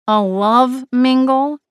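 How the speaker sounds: slow, disbelieving echo; incredulous repeat. A narrator's reading. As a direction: drag out the question in disbelief. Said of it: "Flapjack repeated once she'd gone".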